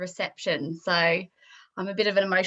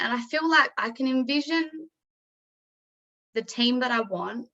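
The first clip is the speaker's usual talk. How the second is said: higher pitched, voice near breaking; pushing through rising emotion, nearly crying; holding back tears; 4 semitones higher than usual. Real, not acted. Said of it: "I'm about to start getting teary".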